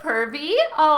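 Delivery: ordinary talk, nothing marked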